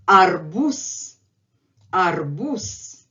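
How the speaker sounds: "Arbuz" said as ar-BOOS, rising on the last syllable; voiceless final s. Shown as a demonstration. Teacher says it again for learners, final z devoiced. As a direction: end "Arbuz" on a long hissed s. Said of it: "Let's say it again".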